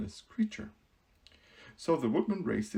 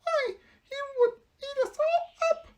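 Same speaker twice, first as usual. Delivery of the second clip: very high, loud startled squeak; frightened cry; 6 dB louder than usual. Acted. Mouse queen hearing of the lion, alarmed squeal.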